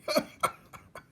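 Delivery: breathy wheezy cackle; wheezing laugh. Acted. Old rat laughs so hard he must sit, wheezing laugh. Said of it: "Botticelli laughed so hard".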